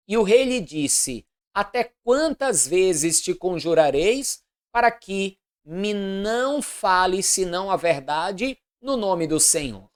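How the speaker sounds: loud, declamatory Bible reading; formal proclamation of an Old Testament narrative; solemn scripture reading. A narrator's reading. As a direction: read scripture aloud with measured, deliberate phrasing.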